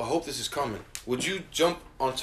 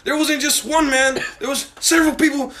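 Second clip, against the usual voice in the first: loud, higher, swearing in anger; heated rant; 7 semitones higher, 5 dB louder than usual. Real, not acted. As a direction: vent loudly about a rude stranger. Heated rant about bar patron.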